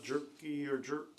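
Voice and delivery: ordinary talk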